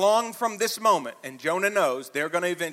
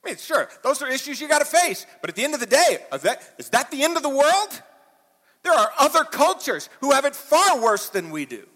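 higher, louder pulpit exhortation; full-force preaching; 9 semitones higher, 6 dB louder than usual. Acted. Pastor urging the congregation to quit worrying, loud preaching. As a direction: drive it home like a revival preacher.

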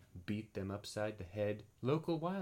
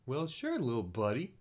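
friendly Sophie voice; 5 semitones higher, 5 dB louder than usual. Acted. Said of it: "said Sophie".

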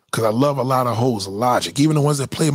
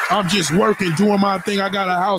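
higher, mocking whiny rant; hammed-up bitter nice guy, raised pitch; bitter good guy; 6 semitones higher than usual. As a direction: play the jilted guy pretending he's fine. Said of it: "that's how he talks".

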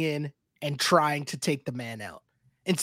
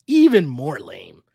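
"Even more lame" dragged out slowly and louder; slow emphatic repeat; 6 semitones lower, 6 dB louder, slower than usual. Retold or imitated. Co-host repeating his verdict on Emmanuel, slow and louder. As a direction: stretch every word of "Even more lame" in disgust.

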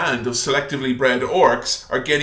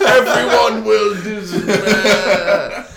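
high, loud and slow filtered voice; voice changer voice; 7 semitones higher, 6 dB louder, much slower than usual. Retold or imitated. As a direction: boom it out like a scary filter. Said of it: "Voice changer".

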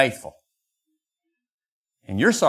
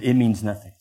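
usual speaking voice

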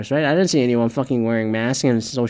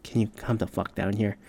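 muttered retort; 2 semitones lower, 9 dB quieter than usual. Retold or imitated. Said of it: "I was like".

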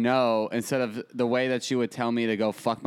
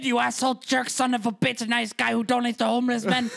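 much higher put-on old Italian grandma; 11 semitones higher than usual. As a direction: squeal it like a cranky old grandma. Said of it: "If she would have finished".

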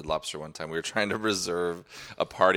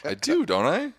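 mock reply; 12 semitones higher than usual. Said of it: "It's just like".

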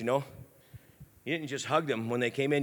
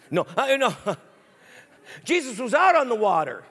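ordinary talk, nothing marked

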